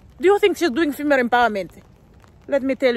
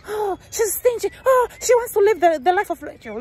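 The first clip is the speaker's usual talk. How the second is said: mocking gossip; 9 semitones higher than usual. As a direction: shriek the gossip like a catty critic. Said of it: "Then after that, say".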